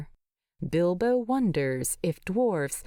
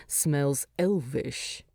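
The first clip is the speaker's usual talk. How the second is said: low slow grunted line; gruff grunt; 4 semitones lower, slower than usual. A narrator's reading. Thorin to Bilbo, a low slow grunt. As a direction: grunt it out dismissively. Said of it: "he grunts".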